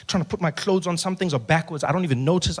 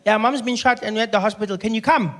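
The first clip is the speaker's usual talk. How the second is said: put-on polite child's voice, pitched up; unbothered small boy asking nicely; calm little brother; 4 semitones higher than usual. Little brother asks for a hospital visit, calm high child voice. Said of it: "calmly asking".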